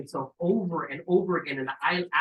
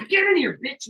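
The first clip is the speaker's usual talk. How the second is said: much higher, loud comic yell; yelling at the snake; 9 semitones higher, 8 dB louder than usual. Retold or imitated. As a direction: bark it at him like you're losing it.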